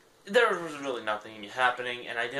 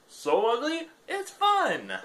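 much higher, squealing cartoon voice; squeaky high repeat; 5 dB louder, slower than usual. Repeats the line as her new bio.

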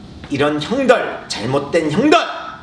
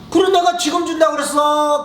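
teasing bully; 12 semitones higher than usual. Acted. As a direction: play dumb with a sneer. Bully mocking word games.

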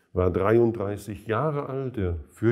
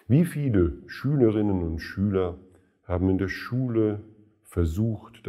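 usual speaking voice

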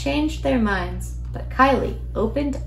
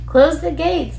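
high, loud urgent call; raised storybook cry; 6 semitones higher, 6 dB louder than usual. A narrator's reading. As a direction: shout an order to the others. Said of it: "they called out".

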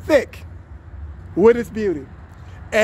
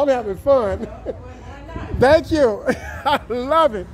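laughing; slower than usual. Real, not acted. Laughing while greeting people on camera.

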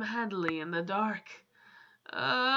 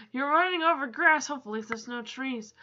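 ordinary delivery

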